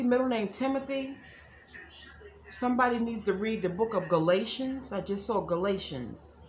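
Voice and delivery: ordinary talk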